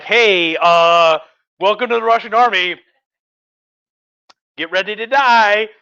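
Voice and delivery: big, loud, comically blunt welcome; mock army welcome. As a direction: call out to new recruits, hammed up. Imagined army greeting new recruits.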